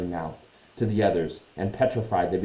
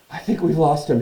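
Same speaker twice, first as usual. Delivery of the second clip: a touch out of breath, higher and louder; panting; 7 semitones higher, 5 dB louder than usual. Acted. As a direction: say it as if lightly winded. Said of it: "Harry panted".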